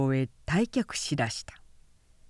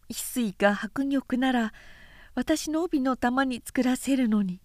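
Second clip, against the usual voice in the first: put-on higher, softer girlish voice; lightly voiced young wife, a little softer; innocent young bride; 6 semitones higher, 3 dB quieter than usual. A narrator's reading. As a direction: wish aloud like a naive fifteen-year-old bride. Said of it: "あどけない新妻は".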